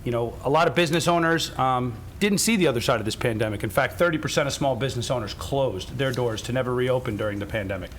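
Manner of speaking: ordinary talk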